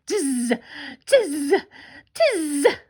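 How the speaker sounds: buzzy little monkey chatter; separate, drawn-out buzzing mimic sounds; teasing monkeys. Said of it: "the monkeys only shook their fingers".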